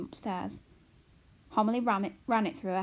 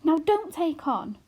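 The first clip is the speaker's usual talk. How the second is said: put-on soothing girl; 7 semitones higher, 7 dB louder, slower than usual. A narrator's reading. Arrietty soothes.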